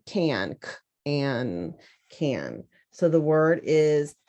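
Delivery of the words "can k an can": can split into k and an, then blended; sounding out. Shown as a demonstration. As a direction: stretch each sound apart. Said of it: "next sound".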